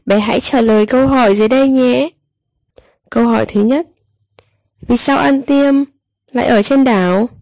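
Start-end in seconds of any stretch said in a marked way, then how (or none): none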